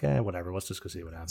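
low, quiet, can't-be-bothered mumble; apathetic shrug. Retold or imitated. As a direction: shrug it off, whatever. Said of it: "of apathy".

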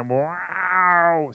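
imitating a giant cat's meow. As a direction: meow like a big cat. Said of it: "un chat géant qui fait".

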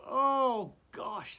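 loud, high startled Oh; surprised oh. Real, not acted. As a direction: exclaim Oh in surprise. Startled exclamation.

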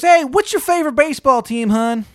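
loud, high, exaggerated Maryland twang; terrible Maryland accent. Baba Yaga greeting a newcomer, bad Maryland accent. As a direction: holler in a hammed-up Maryland accent. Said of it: "She's got the shittiest Maryland accent".

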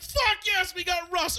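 high, loud screaming celebration; overjoyed yell, acted out; ecstatic shout. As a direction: scream it like your team just won. Acts out celebrating the trade.

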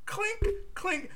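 mock editing clicks. Host imitating cutting the scene in an editor, high and soft. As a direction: tap out the cuts lightly.

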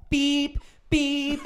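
high-pitched imitated alarm beeps; high beeping. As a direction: squeak out the beeps like a smoke alarm. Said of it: "the girl goes like".

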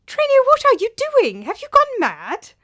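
high, loud indignant demand. A narrator's reading. Old master dragged away, loud high indignant demand. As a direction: demand an answer from a crazed slave. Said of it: "demanded Theopropides".

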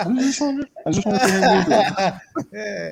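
high, giggling through the words; laughing. Real, not acted. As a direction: laugh while asking. Joking about googly eyes.

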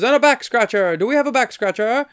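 high-pitched goofy waiter call; hammed-up, loud, high imitation of a waiter; wacky waiter. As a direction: sing out the gag to the kitchen. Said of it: "And then he goes".

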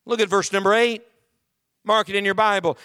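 ordinary delivery

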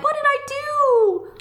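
imitating a tiny, high-pitched, slow squeak of a sad girl; mouse voice. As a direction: mimic her high little mouse voice, drawn out. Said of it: "high-pitched mouse voice".

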